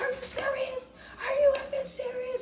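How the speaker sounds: very high squealed disbelief; retold incredulous outrage. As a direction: mimic yourself squeaking in outrage.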